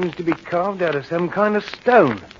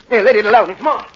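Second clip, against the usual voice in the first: raised, higher warning; possessive anger; 4 semitones higher, 5 dB louder, faster than usual. Acted. Henry to Millie reaching for the knife, possessive. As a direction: order her off it, loudly. Snaps at wife touching knife.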